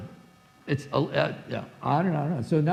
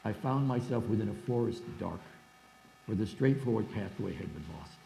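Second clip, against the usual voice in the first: reading the translation; 3 semitones lower than usual. Shown as a demonstration. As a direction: read the verse aloud to the class. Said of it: "here's how he translated".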